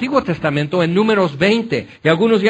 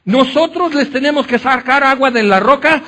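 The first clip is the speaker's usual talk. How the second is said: angry Moses; 8 semitones higher, 3 dB louder than usual. Retold or imitated. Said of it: "ya están enojados".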